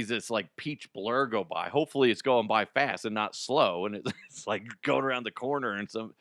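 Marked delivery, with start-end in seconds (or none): none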